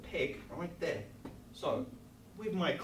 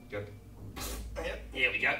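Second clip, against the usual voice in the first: cheering on; 7 dB louder, much faster than usual. Real, not acted. Coaching kids while glue squirts.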